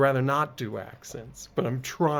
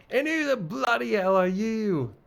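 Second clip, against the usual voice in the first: loud, high British outrage; British accent; 8 semitones higher, 4 dB louder than usual. Acted. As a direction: demand it in an indignant British accent. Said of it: "this game wants me to do accents".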